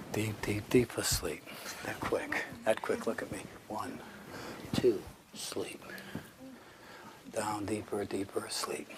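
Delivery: hushed, low, slow near-whisper; very soft, soothing hypnotist voice; hypnotic induction. Real, not acted. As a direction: lull them into deep sleep with long pauses.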